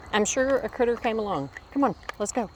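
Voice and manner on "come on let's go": just say it over to yourself, more easily; milder repeat of the dream call. Retold or imitated. Repeating the dream phrase to herself, only lightly raised. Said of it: "it's simply a phrase".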